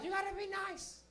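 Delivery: put-on high, soft accented grumble; spiteful mom mutter. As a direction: badmouth the friend after she leaves.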